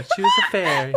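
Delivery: high squeal of laughter; laughing. Pretend. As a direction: laugh through a fairy voice. Laughing fairy impression.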